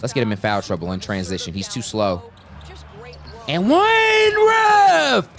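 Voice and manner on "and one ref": high, loud, drawn-out yell; shouted appeal. Real, not acted. Gamer to the ref demanding an and-one, shouted appeal. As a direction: scream it at the ref, stretching every word. Calling for foul after basket.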